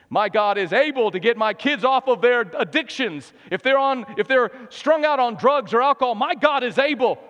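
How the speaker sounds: high, impassioned proclamation of faith; fervent preaching. Real, not acted. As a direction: preach it urgently over the congregation. Preaching at full force.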